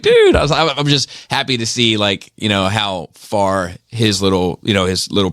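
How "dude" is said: a high, loud burst of excitement; excited exclamation. Retold or imitated. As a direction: blurt it out, amazed. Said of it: "And I was like".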